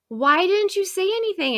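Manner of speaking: high-pitched upset protest; retold upset. Teacher confronting kid over the mispronounced name, upset and high. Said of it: "I was so upset".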